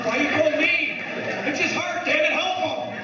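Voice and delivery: high-pitched frantic alarm, played for laughs; hammed-up emergency panic; mock panic. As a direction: panic and plead, pitch jumping way up. Panicking at brother's call about Dad.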